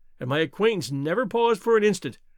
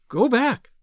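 high, loud urgent woman; urgent warning; 6 semitones higher, 5 dB louder than usual. A narrator's reading. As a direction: blurt the warning out. Sudden warning to a stranger.

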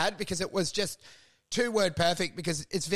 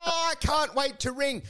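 mock shocked gossip; 8 semitones higher than usual. Gossip hearing juicy news on the phone, high mock shock. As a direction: act thrilled and scandalized by the news.

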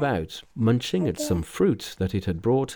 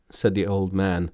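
ordinary delivery, nothing marked

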